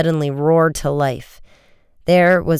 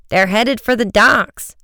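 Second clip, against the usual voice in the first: urgent, raised-pitch call; mild narrated shout; 6 semitones higher, 3 dB louder than usual. A narrator's reading. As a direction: call out an alarm, moderately loud. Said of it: "Joe shouted".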